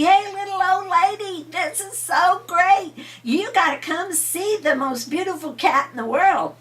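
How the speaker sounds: high, loud, thrilled call to his wife; excited old man calling. A narrator's reading. Old man calls his wife out to see the cat, high and thrilled. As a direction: shout it up high with delight. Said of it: "he yelled at the little old lady".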